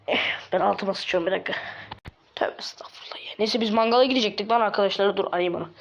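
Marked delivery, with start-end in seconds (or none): 2.3-3.3 hushed, whispered aside; whispered exclamation. Pretend. Speaker to himself after an absurd tale, whispered. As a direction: whisper it under your breath.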